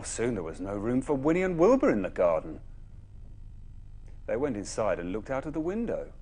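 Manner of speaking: plain reading voice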